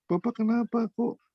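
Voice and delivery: put-on high, slow child's voice; high, slow voice of a puzzled child; child asking. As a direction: imitate his little child asking innocently. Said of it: "anak saya sampai bilang".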